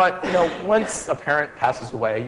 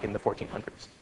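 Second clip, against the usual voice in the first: ordinary talk, nothing marked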